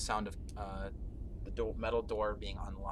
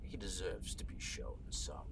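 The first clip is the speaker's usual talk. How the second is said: soft, lower, half-whispered gloating; sinister whisper; 3 semitones lower, 7 dB quieter than usual. Acted. Villain lord gloating to guards about the prisoner, sinister whisper. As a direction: savour each word like a cruel secret.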